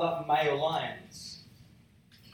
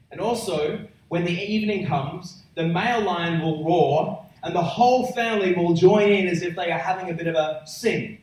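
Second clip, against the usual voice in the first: ordinary talk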